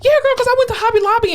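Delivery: breezy gossip right next to death; gossipy chatter. Retold or imitated. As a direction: chat about errands like nothing's wrong. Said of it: "you're just like".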